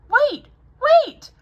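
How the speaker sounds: high, loud call after them. Acted. Anna to departing townspeople, loud high call. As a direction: call them back before they go. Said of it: "Anna calls after them".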